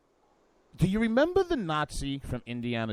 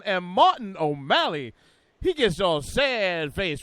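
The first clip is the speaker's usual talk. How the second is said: punchy, high-energy show-opening voice; hype radio announcer; 6 semitones higher, 5 dB louder than usual. Acted. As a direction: sell the rundown like a radio hype man.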